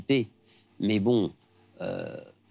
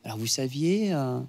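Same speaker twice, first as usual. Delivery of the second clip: higher, curious questioning voice, imitating an interviewer; mock interviewer question; 4 semitones higher than usual. The singer imitates an interviewer asking the CEO, higher mock question. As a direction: ask it like a probing journalist. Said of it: "quand on lui demande".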